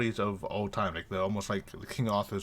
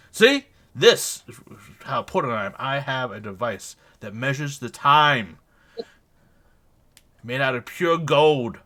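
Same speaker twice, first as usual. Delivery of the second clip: a hint of a puffed-up boast, loud at the start; smug bragging; 2 semitones higher, 8 dB louder than usual. Sir Henley bragging to the supervisor, loudly smug. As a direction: show off the gold watch like a peacock. Gentleman boasts about his pocket watch.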